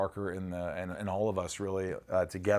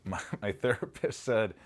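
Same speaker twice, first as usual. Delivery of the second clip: ordinary delivery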